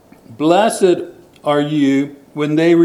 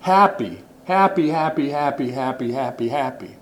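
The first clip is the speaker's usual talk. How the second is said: fading repetition; 3 semitones lower, 5 dB quieter than usual. Acted. Repeating happy, fading away.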